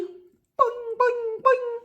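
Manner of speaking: high springy boing sounds; bouncy boing. Acted. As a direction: bounce like a bunny. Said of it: "Hop like a bunny".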